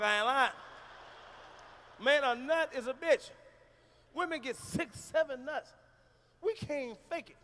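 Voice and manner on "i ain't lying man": ordinary talk, nothing marked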